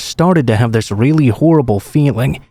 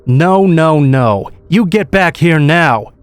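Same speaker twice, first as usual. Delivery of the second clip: higher-pitched order called out in narration; mild Southern yell; 5 semitones higher, slower than usual. A narrator's reading. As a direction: call them back with a restrained yell. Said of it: "one of them yell behind me".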